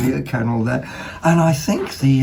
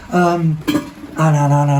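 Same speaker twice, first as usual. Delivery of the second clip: ordinary talk, nothing marked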